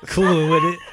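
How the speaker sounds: loud, high-pitched words through laughter; laughing. Real, not acted. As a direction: shout it out while cracking up. Group cracks up at joke.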